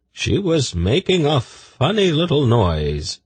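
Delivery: high, slow, careful voice; lightly voiced young eunuch. A narrator's reading. Tzadi telling of the snake, high slow voice. Female character voice.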